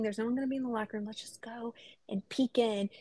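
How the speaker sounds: half-whispered retelling of a secret plan; hushed sneaky suggestion. Retold or imitated. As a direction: quietly egg a friend on to peek. Retold sneaky plan to peek.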